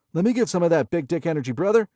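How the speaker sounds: high, fast, loud bro voice; hyped gambler. A narrator's reading. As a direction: pump up the shooter like a frat bro. Gambler hyping Billy.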